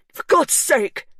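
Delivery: high, loud; angry outburst. A narrator's reading. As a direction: snap in fury. Hugh angry.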